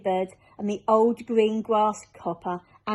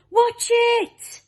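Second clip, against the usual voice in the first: much higher, loud warning cry; sharp warning cry; 8 dB louder than usual. Acted. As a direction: yell a warning as the room lurches. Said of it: "cried Miss Spider".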